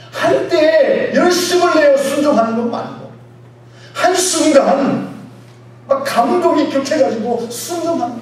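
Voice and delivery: loud, high, building sermon list; full-force preaching. Real, not acted. Preacher contrasting brief zeal with lasting obedience, full-force preaching. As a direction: punch out each clause at shouting force.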